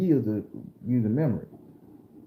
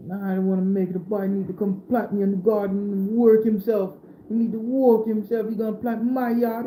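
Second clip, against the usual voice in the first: high raised voice of an angry Jamaican man; Jamaican man's demand; 8 semitones higher than usual. Retold or imitated. Jamaican man telling Grandma what the boy owes him, high and indignant. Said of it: "speaking that patois shit to Grandma".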